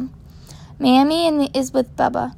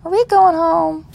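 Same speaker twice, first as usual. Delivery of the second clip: slow, higher tired little voice; exhausted child; 5 semitones higher than usual. Acted. Worn-out little boy asks his mother, slow tired voice. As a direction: ask it drowsily, worn out. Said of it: "Now Izzy is exhausted".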